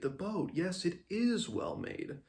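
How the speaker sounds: lightly voiced sheepish village idiot; Simon. A narrator's reading. Simon covering up his mistake about the boat, foolishly agreeing. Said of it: "nodding like a village idiot".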